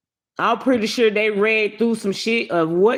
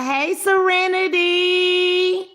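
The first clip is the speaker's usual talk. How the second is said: sing-song greeting; 8 semitones higher, much slower than usual. Acted. Drawn-out greeting to viewer.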